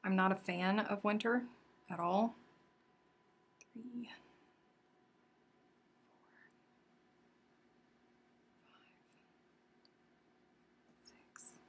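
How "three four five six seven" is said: counting under breath. Real, not acted. Counting stitches while crocheting.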